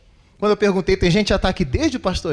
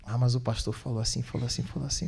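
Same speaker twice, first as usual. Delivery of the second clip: much softer, lower repeated grumble; mock mumbled complaint; 6 semitones lower, 10 dB quieter than usual. Pastor mimics petty complaints about his words, soft low mutter.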